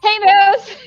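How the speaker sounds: high, uneasy call with a laugh in it; acted-out wary hello, pitched well up; nervous greeting. Hiker greets a moose close by, high nervous half-laugh.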